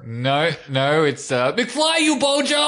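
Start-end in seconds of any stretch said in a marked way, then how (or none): 1.5-2.7 high, slow, laughing Biff yell; Biff quote. Pretend. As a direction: bark it like a bully, laughing. Quoting Biff's movie line.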